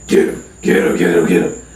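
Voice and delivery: low, urgent repeated command; egging on a dog. Retold or imitated. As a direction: sic the dog on someone in a low voice. Cousin sics dog on him.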